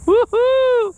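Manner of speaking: high, loud, drawn-out cheer; excited whoop. Real, not acted. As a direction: whoop like you just landed one. Third catfish caught, whooping.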